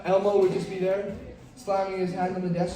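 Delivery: usual speaking voice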